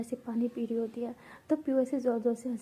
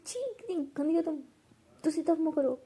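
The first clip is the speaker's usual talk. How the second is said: higher-pitched "chhi"; disgusted scolding; 5 semitones higher than usual. Acted. घिन और शर्म भरी डाँट. (घिन भरी डाँट)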